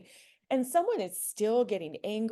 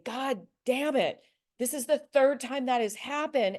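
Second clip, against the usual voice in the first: mock angry complaint, louder and higher; angry patient; 5 semitones higher, 6 dB louder than usual. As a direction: play the fed-up patient swearing. Angry patient over rescheduling.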